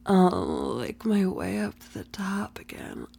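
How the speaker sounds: soft teasing whisper; breathy ASMR whisper. Acted. Whispered roleplay.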